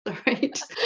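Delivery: soft laugh through the words; kind gentle laugh. Real, not acted. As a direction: apologise while still laughing. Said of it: "I'm not laughing at you".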